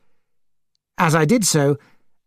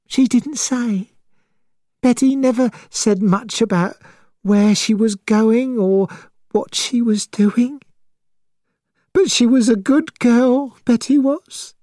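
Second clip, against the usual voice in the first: grieving mother voice; 9 semitones higher than usual. Acted.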